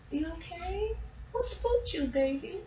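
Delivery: high, very soft cooing; tender pet talk. Real, not acted. Owner comforting spooked Leo, tender and hushed. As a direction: check on the pet in a gentle tiny voice.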